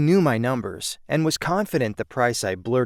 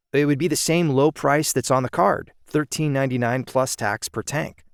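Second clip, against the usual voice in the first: ordinary delivery, nothing marked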